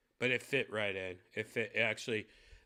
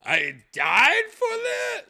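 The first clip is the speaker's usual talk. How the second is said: mock outraged yell, much higher and louder, slowing at the end; Steve Jobs outraged; 11 dB louder than usual. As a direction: scream in disbelief like a betrayed founder. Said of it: "he'd be like".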